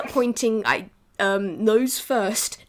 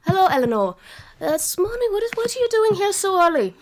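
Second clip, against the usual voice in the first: louder, higher doting granny greeting; old cat grandma; 9 semitones higher, 5 dB louder, faster than usual. Acted. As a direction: greet the girl warmly with surprise. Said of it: "practice this voice or this accent".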